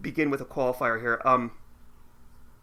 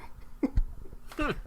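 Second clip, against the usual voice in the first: breathy laugh; 11 semitones higher, 5 dB quieter than usual. Real, not acted. Laughs at weatherman trivia.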